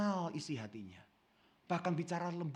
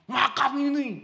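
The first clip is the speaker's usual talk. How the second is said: mock brusque lover; 10 semitones higher, 9 dB louder, slower than usual. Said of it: "ngomongnya lembut ya".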